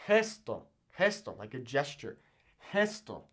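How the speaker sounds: gesto with a rasped throat H; throaty Spanish G. Shown as a demonstration. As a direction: push gesto from the back of the throat. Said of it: "that kinda weird throat sound".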